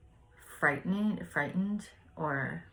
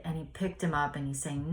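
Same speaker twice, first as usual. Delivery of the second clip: ordinary talk, nothing marked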